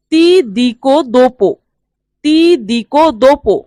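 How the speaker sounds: very slow, careful Italian; deliberate teacher's dictation pace; slow model phrase. Shown as a demonstration. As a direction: spell each syllable out for learners. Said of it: "teacher models Italian phrase slowly".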